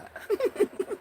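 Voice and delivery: short high-pitched giggle. Real, not acted. Streamer giggling after a yawn and sneeze, high giggle. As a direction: burst out giggling.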